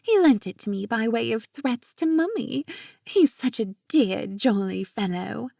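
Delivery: put-on posh British accent. A narrator's reading. Jenna boasting of her brother's card, posh British accent. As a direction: play the posh Brit, jolly and affected. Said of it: "replied with a posh British accent".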